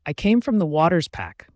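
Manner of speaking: usual speaking voice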